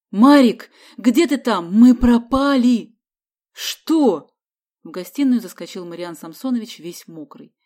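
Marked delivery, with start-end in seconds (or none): 0.1-3.0 loud, high calling to another room; panicked shout. A narrator's reading. Man calls brother over ruin, panicked shout. 3.5-4.3 loud, high startled question; alarmed question. A narrator's reading. Brother rushes in, startled.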